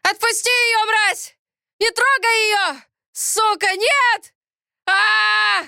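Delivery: high, strained, desperate yelling; voice-breaking screams. A narrator's reading. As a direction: scream in helpless fury till the voice breaks. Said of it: "окончательно срывая голос". (отчаянный крик, срывая голос)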